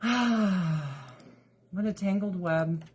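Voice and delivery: slow, sighing resignation; sighed rueful remark. Real, not acted. Vlogger sighing over her kitten drama, slow and resigned. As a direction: let it out on a long sigh, slowly.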